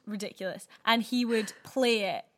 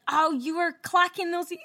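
high, loud mock complaint; complaining neighbour; 7 semitones higher, 5 dB louder than usual. Retrying the neighbour's complaint for a friend, high hammed-up voice. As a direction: attempt the neighbour and give up. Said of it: "I can't say it".

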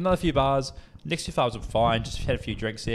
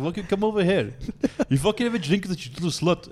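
high-pitched mock-Russian demand; yelling Russian guy; 7 semitones higher than usual. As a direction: imitate a pushy Russian yelling at girls. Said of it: "He's, like, yelling at them".

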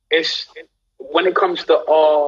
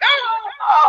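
much higher imitated whoop of delight; crowd cheering; 3 dB louder than usual. Celebrities cheering Cynthia's splits, high mock whoop. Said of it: "everyone was like".

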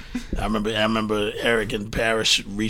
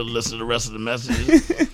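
ordinary delivery

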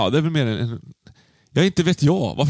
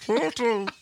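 high, soft mock call; teasing high-pitched imitation of a call; imitated calling; 11 semitones higher, 5 dB quieter than usual. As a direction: mimic someone calling out in a small, high voice. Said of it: "låter lite som du som ropar".

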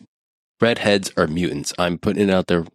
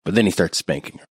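usual speaking voice

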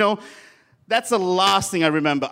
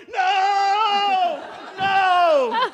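much higher, sing-song "No! No!" wail; panicked yelling; 11 semitones higher than usual. Retold or imitated. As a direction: wail "No!" like a caught fool.

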